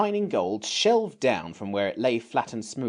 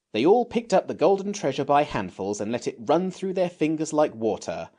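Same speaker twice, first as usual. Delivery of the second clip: usual speaking voice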